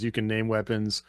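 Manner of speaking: ordinary talk, nothing marked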